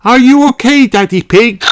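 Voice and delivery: high, loud concerned call; anxious mother pig; worried Mummy Pig. A narrator's reading. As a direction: check on her fallen husband. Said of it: "asked Mummy Pig".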